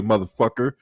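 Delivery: normal talking voice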